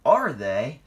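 loud, startled splutter; loud indignant surprise. A narrator's reading. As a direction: blurt it out, taken aback. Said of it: "the Fat Controller spluttered".

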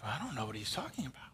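low, hushed, hurried aside; confused aside. Retold or imitated. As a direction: mutter it low and fast, as a puzzled disciple. Disciples' muttered confusion, imitated.

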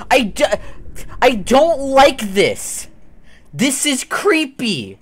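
high, slow, frightened whine; scared and creeped out, pitch up; scared horror game streamer. Real, not acted. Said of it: "you've seen genuine fear from me now".

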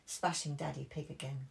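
usual speaking voice

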